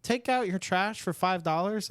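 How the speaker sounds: imitating an eager door-knocking kid. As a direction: pitch it up like a kid asking for work. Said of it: "a kid knocked on the door".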